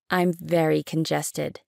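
ordinary delivery

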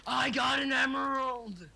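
slow, shouted with delight; excited shout. Real, not acted. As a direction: yell out the find. Excited find shouted in game.

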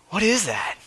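high, puzzled dinner-table question; mock bafflement. Husband questioning his wife's cooking, mock bafflement. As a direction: pitch it up in pretend puzzlement.

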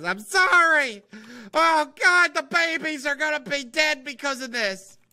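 high, loud mock lament; dramatic high-pitched outcry; overdone dismay. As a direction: wail the apology, hammed-up. Crying out over the ruined build.

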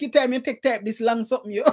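usual speaking voice

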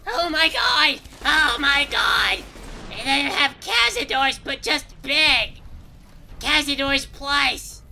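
high, slow alarmed shout; panicked shouting. Real, not acted. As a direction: yell it out in shock. Ambushed by giant Cazador.

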